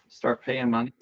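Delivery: ordinary talk, nothing marked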